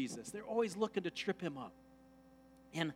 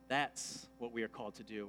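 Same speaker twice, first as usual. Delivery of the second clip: normal talking voice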